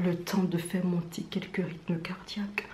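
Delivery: eerie unvoiced whisper. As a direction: whisper it to give chills. Spooky intro whisper.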